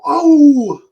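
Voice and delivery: a high, loud mock "Oh" of alarm; imitated crowd gasp. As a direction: echo the crowd's shocked gasp. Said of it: "did you hear someone go".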